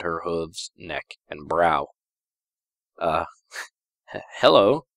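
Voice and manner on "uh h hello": awkward stammered hello with a nervous laugh; a lightly voiced flustered mutter; muttered dumbly. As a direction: stumble over a dumb hello. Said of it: "I muttered dumbly".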